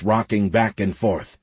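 plain reading voice

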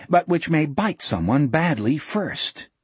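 ordinary delivery

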